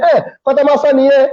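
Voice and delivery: retort. Retold or imitated. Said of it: "I said".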